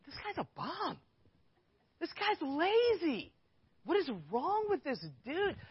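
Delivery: high, drawn-out scornful muttering; judgmental inner voice. Retold or imitated. Worker silently judging customer's son, high scornful inner voice. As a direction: sneer at the lazy guy in your head.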